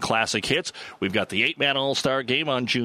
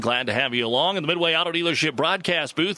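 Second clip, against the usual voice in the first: upbeat radio sponsor read, pitched up; announcer read; 4 semitones higher than usual. Acted. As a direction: sell the dealership names like a radio host. Show intro and sponsor read.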